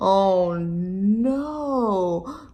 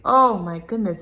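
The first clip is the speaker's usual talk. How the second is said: plain reading voice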